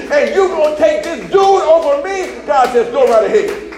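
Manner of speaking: whooping preacher voicing God's hurt; preaching at full force. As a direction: build to a roaring climax as God scorned.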